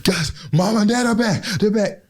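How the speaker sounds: high, urgent alarm call; panicked warning to siblings. Retold or imitated. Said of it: "I'm trying to tell them".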